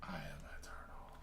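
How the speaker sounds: whispered, deep otherworldly being; low eerie cosmic whisper; vast deep cosmic voice. Acted. Booming declaration of eternal being.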